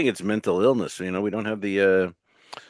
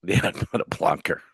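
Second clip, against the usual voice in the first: whispered aside; 3 dB quieter than usual. Real, not acted. Laughing aside after laugh.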